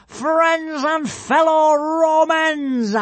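high, loud, drawn-out proclamation; villainous yell. A narrator's reading. Alvin hails the stadium crowd from the pavilion, yelling. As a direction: announce it grandly to a stadium, very slowly. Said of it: "yelled Alvin the Treacherous".

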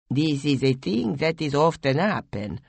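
put-on lightly accented broken speech; foreign-accented Dipeju. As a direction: speak as a calm foreigner.

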